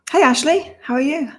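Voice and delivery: high, lifted hello; light up as you greet her; excited greeting. Real, not acted. Host greeting a viewer in the live chat, excited hello.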